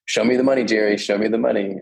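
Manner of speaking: ordinary talk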